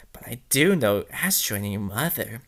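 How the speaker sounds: low, soft whispered menace; creepy villain whispering; sinister whisper. Acted. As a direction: breathe it like a hidden villain.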